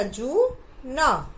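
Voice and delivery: stretched syllable by syllable; slow syllables. Shown as a demonstration. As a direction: sound out each syllable slowly. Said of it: "ज के नीचे उ की मात्रा".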